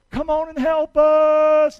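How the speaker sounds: slow, loud call across the water. Acted. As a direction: haul them over with a big slow shout. Fishermen calling to the other ship.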